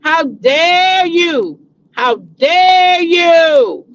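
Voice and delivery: mock outrage. Repeating the critics to Portia, drawn-out mock outrage. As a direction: stretch each how dare you like an outraged church lady. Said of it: "They said".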